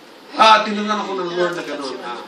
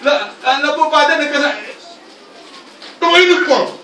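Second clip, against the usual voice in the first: loud, high tearful plea; son pleading to father; 8 semitones higher, 7 dB louder than usual. Retold or imitated. Prodigal son begging his father, loud and high. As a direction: cry out to the father. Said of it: "naalala niyo yung anak".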